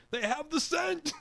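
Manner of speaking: very high-pitched excited cry. A narrator's reading. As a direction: call it out high over your shoulder. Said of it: "the handler cried out".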